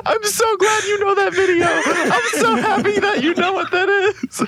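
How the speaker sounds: high squealing laugh through the words; cracking up while talking; laughing hard. Real, not acted. Laughing hysterically at meme.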